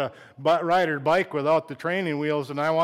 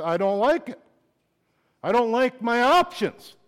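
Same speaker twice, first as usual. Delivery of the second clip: rising louder and higher into an angry cry; frustrated outburst; 10 semitones higher, 9 dB louder than usual. Retold or imitated. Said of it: "I didn't say it like that".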